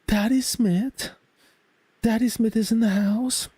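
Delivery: squeaky excited announcer call; high hype voice. Acted. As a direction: greet him like a hype man. Hyping a viewer's arrival.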